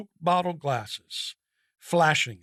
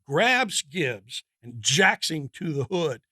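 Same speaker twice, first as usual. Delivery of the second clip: plain reading voice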